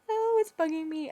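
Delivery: frustrated whine. Real, not acted. Makeup vlogger can't think of the product name, frustrated whine.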